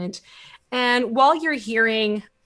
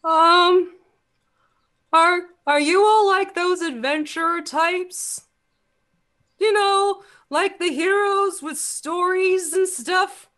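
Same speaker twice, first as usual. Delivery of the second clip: put-on high, eager boy's voice, a little hesitant; wide-eyed kid asking about heroes; curious young boy; 9 semitones higher, 4 dB louder than usual. Young boy asks the party if they are adventurers, high and eager.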